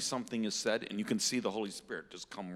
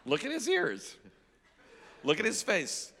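much higher, insistent excitement; excited pointing-out; 10 semitones higher, 4 dB louder than usual. Real, not acted. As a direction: urge the room to look, pitched high. Excitedly points out man to audience.

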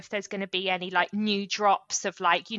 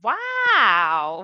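amazed wow; 8 dB louder than usual. Real, not acted. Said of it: "Why don't you just say that like".

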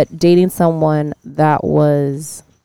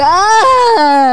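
mock shriek; 5 dB louder than usual. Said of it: "I'm like".

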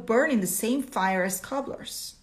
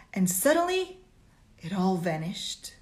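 ordinary talk

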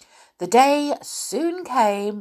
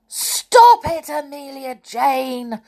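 crying out; 7 semitones higher, 6 dB louder, slower than usual. A narrator's reading. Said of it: "cried Tom when he got the cork".

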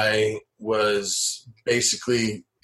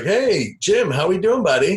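high, loud mock-cheery hello; hearty greeting; 8 semitones higher, 4 dB louder than usual. Retelling a phone call to Jim, high hearty greeting. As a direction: greet an old buddy in a raised, bouncy voice.

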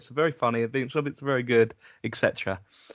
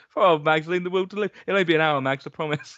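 usual speaking voice

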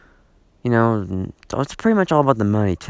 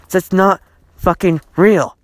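angry outburst; 7 semitones higher, 4 dB louder than usual. Real, not acted. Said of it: "I'm sick of people".

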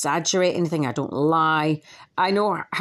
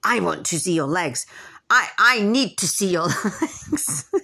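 mocking demanding troll. Mocking a troll who demands to see her legs, lightly put-on. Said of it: "another thing she was doing".

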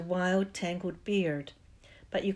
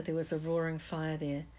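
ordinary delivery

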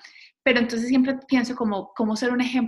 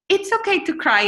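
put-on high little-girl voice, a bit louder; imitating her daughter; 10 semitones higher, 4 dB louder than usual. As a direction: sound like a three-year-old correcting mom. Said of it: "y ella me decía".

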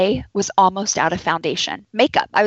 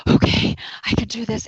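whispered, coaching yourself through pain; whispered self-pep talk. Retold or imitated. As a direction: breathe it out under your breath. Breathing through contraction.

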